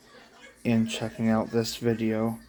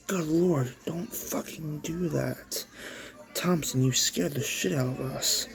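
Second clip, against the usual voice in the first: higher, softer startled scolding; frightened; 4 semitones higher, 5 dB quieter than usual. Acted. Greg scolding Thompson for sneaking up, frightened. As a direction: catch your breath after a jump scare. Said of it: "said Greg in fright".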